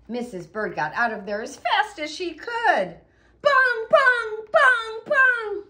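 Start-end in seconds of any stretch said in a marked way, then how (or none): 3.4-5.6 high, loud ringing bell calls; booming bell sounds; loud bell bongs. Acted. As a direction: ring it out like a big bell. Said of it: "It's a loud bell".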